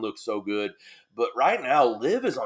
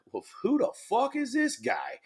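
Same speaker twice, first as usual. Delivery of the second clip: high-pitched mock bafflement; incredulous; 12 semitones higher than usual. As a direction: imitate stunned relatives, voice jumping way up. Voices a family's baffled reaction.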